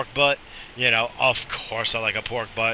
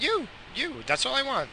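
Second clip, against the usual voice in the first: high and soft, hammed-up devotion; mock tender answer; 13 semitones higher, 6 dB quieter than usual. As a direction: coo it to the wife, much higher and softer. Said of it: "You know".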